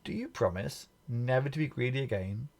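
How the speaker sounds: plain reading voice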